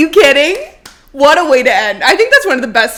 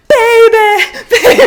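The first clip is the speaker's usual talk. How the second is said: high, loud excited squeal; 11 semitones higher, 7 dB louder, slower than usual. Real, not acted. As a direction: squeal it with glee. Excited retelling of crash.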